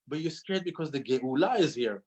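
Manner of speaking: usual speaking voice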